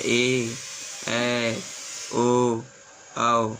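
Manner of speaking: drawn-out long vowels ए ऐ ओ औ; long vowels demo. Shown as a demonstration. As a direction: stretch every vowel out. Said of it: "समय लग रहा है".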